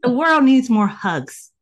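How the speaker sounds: a big, slow, beaming appeal; emphatic declaration. Real, not acted. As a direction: proclaim it warmly to everyone, still glowing from the laugh. Laughing, emphatic call for hugs.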